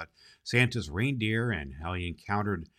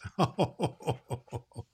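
laugh at own joke; 4 semitones higher than usual. Real, not acted.

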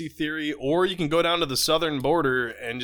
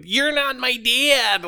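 put-on bratty kid; 9 semitones higher, 4 dB louder, much slower than usual. Kid voiced in a joke.

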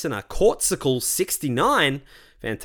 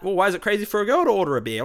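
higher, hurried put-on complaint; mock indignation; 7 semitones higher, faster than usual. Imagined viewer upset about the ad, mock indignant. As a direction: rattle it off as a mock whiny objection. Mocking people who might be upset.

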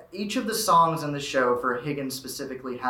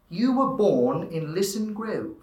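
slow, higher British RP with dropped R's; standard English accent; 5 semitones higher, slower than usual. Shown as a demonstration. Demonstrating the standard English version of the line, RP accent. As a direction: say it like posh stage English, no R in born. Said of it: "if you were in standard English".

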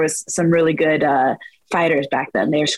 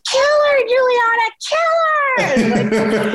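mom screaming; much slower than usual. Retold or imitated. Mom clinging to the cage at a fight, high frantic scream. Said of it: "she'd be like a cat just right on that cage".

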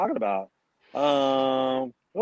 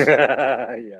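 laughing yeah, louder; amused agreement; 2 semitones lower, 8 dB louder than usual. Real, not acted. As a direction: laugh through it. Listener laughs.

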